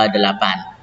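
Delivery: ordinary delivery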